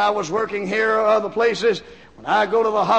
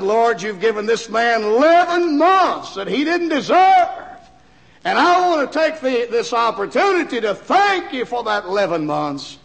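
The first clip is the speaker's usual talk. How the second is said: raised, fervent prayer voice; retold prayer; 2 semitones higher than usual. Preacher thanking the Lord over the man, fervent prayer. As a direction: pray aloud with thanks.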